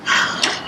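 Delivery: long soft exhale; deep breath out. Real, not acted. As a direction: let out a slow deep breath. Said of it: "take a deep breath".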